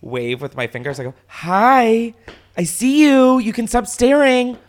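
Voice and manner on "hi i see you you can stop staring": high, loud, sarcastic sing-song hello; bitchy mock greeting. Taunting the staring driver with a bitchy hello, loud and high. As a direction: wave him off in a snotty, sugary voice. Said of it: "a bitchy, like, wave".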